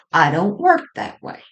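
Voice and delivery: ordinary talk, nothing marked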